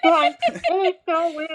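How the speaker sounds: giggling through the words; laughing at the awkward memory. Real, not acted.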